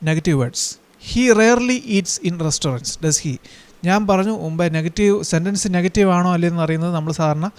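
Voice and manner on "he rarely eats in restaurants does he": very slow and a bit higher, word by word; slow dictation. Shown as a demonstration. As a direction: read the example out slowly for copying. Teacher dictates example sentence. (പതുക്കെ, അല്പം ഉയർന്ന ശബ്ദത്തിൽ പറഞ്ഞുകൊടുക്കുന്നു)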